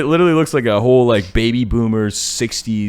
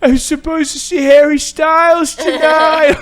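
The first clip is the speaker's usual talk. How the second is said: much higher, slow mock whine; whiny complaint; 6 dB louder than usual. Blinded on acid, whining to his friend about [name]. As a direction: whine like a kid missing the show.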